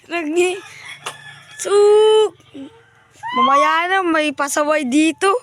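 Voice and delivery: high, loud shout at a troublemaker. Real, not acted. Owner shouting at a pesky kid near his pigeons, loud and high.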